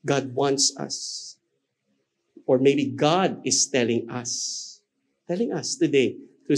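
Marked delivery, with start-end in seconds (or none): none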